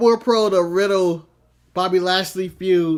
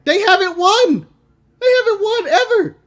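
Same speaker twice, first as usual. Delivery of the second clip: high exasperated; 12 semitones higher, 4 dB louder than usual. Real, not acted. Exasperated joke about losing.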